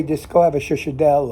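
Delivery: normal talking voice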